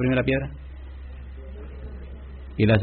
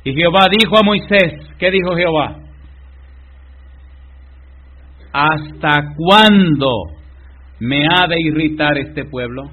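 loud, very slow "¿Hasta cuándo"; preacher thundering God's words; fiery scripture reading; 4 semitones higher, 6 dB louder, slower than usual. Acted. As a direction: drag out God's question heavily. Reading scripture at full force.

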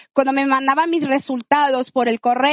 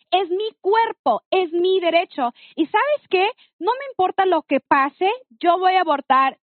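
high-pitched defiant insistence; retold indignant rage; 5 semitones higher than usual. Woman telling boyfriend she will abort regardless, furious defiance. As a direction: lay down your right, pitch well up. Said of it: "salió la leona y me dio un coraje".

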